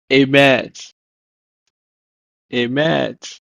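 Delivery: emet said carefully, falling at the end; Hebrew pronunciation. Shown as a demonstration. Teacher gives the Hebrew for truth, careful emet. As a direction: model "emet" for learners, then repeat. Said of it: "it's pronounced as".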